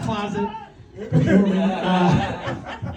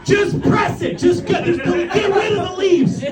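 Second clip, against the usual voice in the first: high, strained comic yell; exasperated pleading; 9 semitones higher, slower than usual. Acted. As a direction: plead as if over a noisy machine. Exasperated plea to the landscaping guy.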